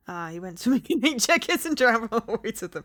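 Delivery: loud, laughing through the joke. Real, not acted. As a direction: laugh while telling it.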